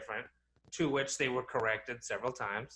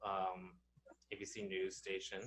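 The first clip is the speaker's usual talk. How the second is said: normal talking voice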